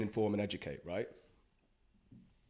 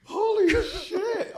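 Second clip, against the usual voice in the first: high, loud, drawn-out amazement; astonished relief; 9 dB louder, much slower than usual. Retold or imitated. Reporter reacting to a narrow escape, amazed. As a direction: stretch it out in high-pitched disbelief.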